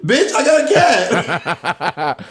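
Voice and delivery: put-on slow, laughing kid brag; kid bragging. Imagined kid home from school, laughing brag. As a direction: ham up a kid showing off. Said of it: "The kids go home and like".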